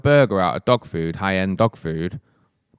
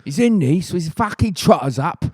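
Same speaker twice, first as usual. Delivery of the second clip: higher, hammed-up cockney impression; mock East London swagger; Danny Dyer; 7 semitones higher than usual. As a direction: put on an emphatic cockney geezer voice. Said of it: "Danny Dyer?".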